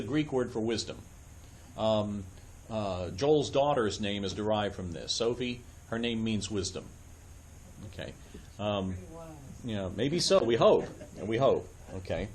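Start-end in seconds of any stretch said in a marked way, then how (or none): none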